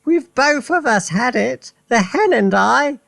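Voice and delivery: put-on high, loud old woman's cry; triumphant Grandma, high and loud; Grandma shouting. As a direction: crow it down from the rooftop at Mary. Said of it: "Grandma shouted".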